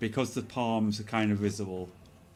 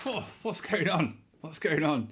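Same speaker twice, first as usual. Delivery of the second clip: surprised; 7 semitones higher than usual. Real, not acted. Surprised by many points.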